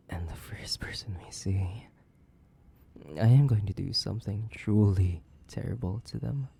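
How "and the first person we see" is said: soft, sinister whisper; hushed threat; menacing whisper. Acted. Menacing aside.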